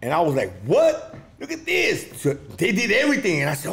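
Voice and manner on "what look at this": much higher, stunned; a retold jaw-dropping moment; shocked disbelief. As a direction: gasp it out in pure amazement. Seeing the twins perform.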